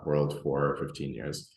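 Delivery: ordinary talk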